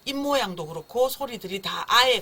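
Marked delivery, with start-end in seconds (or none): 1.9-2.2 loud, open "아에" like the babies' cries; demonstrated "아에" sound. Shown as a demonstration. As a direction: punch out "아에" with an open mouth. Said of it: "가까운 소리들이 났어요". (크게 강조한 "아에" 소리)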